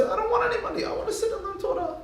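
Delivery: very high, pleading put-on voice; high-pitched protest. Yaakov refuses the money to study, high-pitched protest. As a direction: protest in a comically high little voice.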